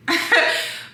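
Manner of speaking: breathy giggle; quick airy laugh at herself. Real, not acted.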